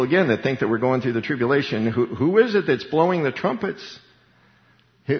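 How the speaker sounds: ordinary talk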